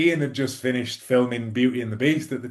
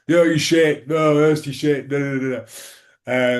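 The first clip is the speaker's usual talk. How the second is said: mock crowd abuse; 4 semitones higher, 6 dB louder, slower than usual. Retold crowd abuse at player.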